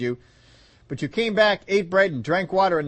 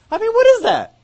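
high, loud laughing question; laughing disbelief; 9 dB louder than usual. Real, not acted. Preacher laughing to the congregation at the absurd story.

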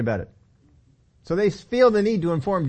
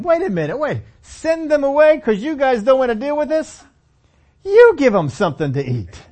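raised, sharp challenge; Jesus with attitude; 6 semitones higher, 5 dB louder than usual. Retold or imitated. Jesus challenging the disciples, sharp edgy retort. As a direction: call them out, a bit of an edge. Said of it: "Jesus has a little bit of an attitude".

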